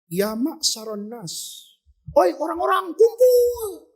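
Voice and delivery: drawn-out call rising high; mock crowd-calling shout. Disciple calling people to gather, mock shout climbing high. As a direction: call everyone together, stretching the words. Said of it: "Dia teriak".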